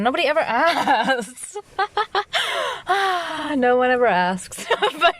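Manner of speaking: laughing through the words, high and giggly; can't stop giggling while saying it. Real, not acted. Vlogger laughing at herself, nobody ever asks.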